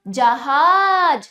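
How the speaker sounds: जहाज slowly and loud, the हा held; slow drawn out. Shown as a demonstration. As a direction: stretch जहाज for repetition. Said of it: "ऐसे ही जहाज".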